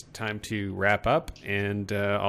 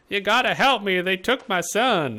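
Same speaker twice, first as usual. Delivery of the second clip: put-on Swedish accent; 12 semitones higher, 7 dB louder than usual. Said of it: "that was my Swedish accent".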